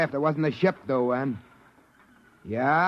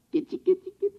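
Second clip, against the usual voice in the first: put-on high cooing baby talk; faster than usual. [name] cooing at the Mocklin baby, high and tender. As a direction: coax the baby like a kitten.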